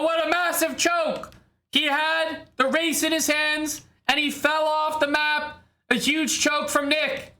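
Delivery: high, hyped race commentary; excited play-by-play. Acted. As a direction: call the race like a sportscaster.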